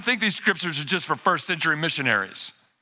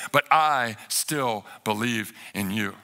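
slow, weighty and lower; resolute faith; 6 semitones lower, slower than usual. Retold or imitated. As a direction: lean on each word of "I still believe", slowly. Repeats Martha's hard-won declaration of faith.

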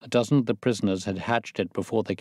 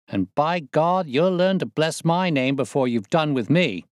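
Prendergast, bold convict; 7 semitones higher than usual. A narrator's reading. Prendergast boasts to his neighbour, higher swaggering voice. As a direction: brag like a cocky con who owns the ship.

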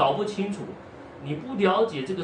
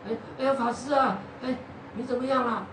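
higher, fussy concerned visitor voice; fussing visitor; 7 semitones higher than usual. Acted. Visiting monk greets the injured monk, higher fussy concern. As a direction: fret over him like a worried caller.

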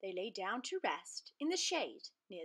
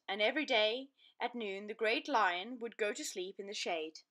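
plain reading voice

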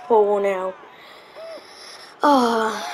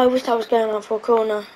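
normal talking voice

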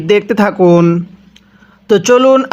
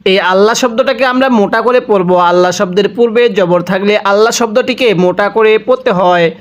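ordinary delivery

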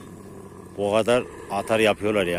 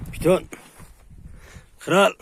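high, loud name call; 10 semitones higher, 4 dB louder than usual. Real, not acted. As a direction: summon each dog with a raised call. Calling dogs by name. (tiz sesle isim seslenme)

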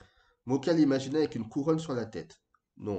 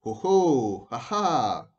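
loud, drawn-out exclamations; crying out; 7 dB louder, much slower than usual. A narrator's reading. Old Clémentine peers into the shining ball, crying out. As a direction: exclaim boldly like a fortune-teller seeing something. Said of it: "et s'écria".

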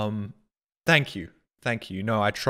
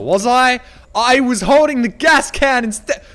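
higher, emphatic indignant comeback; indignant retort; 5 semitones higher, 4 dB louder, slower than usual. Real, not acted. Streamer answering chat's correction, loudly indignant. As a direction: throw it back, building to a loud no.

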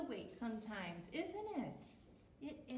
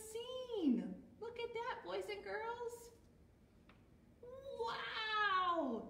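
amazed; 8 semitones higher, slower than usual. Acted. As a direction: gasp at the huge donut for the kids. Amazed at giant donut.

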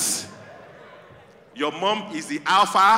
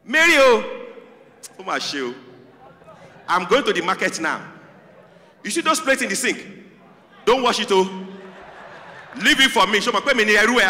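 loud Nigerian mom; 3 semitones higher, 5 dB louder than usual. Retold or imitated. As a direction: bark chores at the child like a strict Nigerian mom. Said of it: "this is how we were trained in Nigeria".

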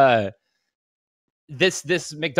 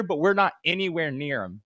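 ordinary delivery

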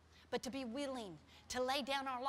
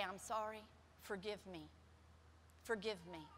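soft, lower, gentle plea; soft lower humble apology; 5 semitones lower, 7 dB quieter than usual. Shown as a demonstration. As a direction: model a humble sorry. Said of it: "just dive right into it and say".

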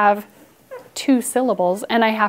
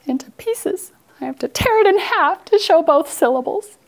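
hammed-up distress; 6 semitones higher than usual. Teacher hams distress at tearing word.

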